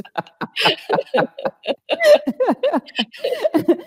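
very high forced laugh; squealing demonstration laugh; harder laughter. Shown as a demonstration. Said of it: "you laugh harder".

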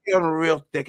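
put-on Italian accent through a laugh; playful, hammed-up Italian accent. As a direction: join in with a mock Italian accent. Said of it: "so I can do that accent".

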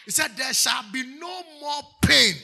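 slow, loud, sung preaching over music; fervent chanted sermon; full-force preaching. Acted. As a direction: preach it out with all your voice.

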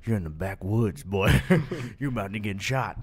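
low, menacing hillbilly voice; low-voiced backwoods threat. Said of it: "It's like".

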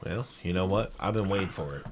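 low mumble. Acted. Repeat said much lower, softer.

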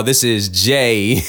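hammed-up announcer voice; mock show intro. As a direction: restart the show like a host. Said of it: "time has rewound".

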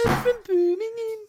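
high-pitched go at a Brummie accent. Shown as a demonstration. Host attempts a Brummie accent for co-hosts. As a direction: put on a Birmingham accent. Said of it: "the Brummie accent".

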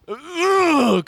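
high exasperated ugh; frustrated groan. Retold or imitated. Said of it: "'cause she's very frustrated".

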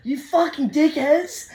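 disbelieving at the mates, high and slow; high slow exasperated insult; high slow outrage. Retold or imitated. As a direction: pitch way up, slow indignant insult. Angry at laughing security.

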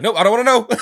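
high, loud, laughing yelp of panic; panicked shout. Retold or imitated. Retelling the movie, shrieks in a laughing panic. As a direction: shriek it like he's running from the truth.

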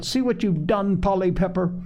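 high-pitched mild exclamation; crying out. A narrator's reading. Joel blames Polly for the broken cup, mild outcry. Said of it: "cried Joel".

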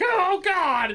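high, loud, frightened yelp; panicked cry. Retold or imitated. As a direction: shriek like you're fleeing. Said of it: "the panda ran away like".

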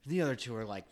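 ordinary delivery

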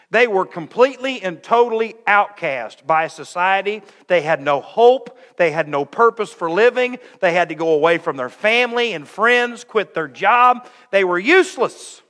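loud, rising preacher's cry; fiery preaching. Acted. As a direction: preach it with full force. Preaching at full force.

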